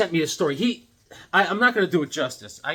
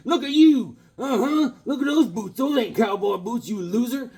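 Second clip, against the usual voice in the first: high, loud mocking bully; taunting hoods; 9 semitones higher, 3 dB louder than usual. The local hoods mock the boy's boots in a high, loud jeering voice. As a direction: jeer at the kid's boots.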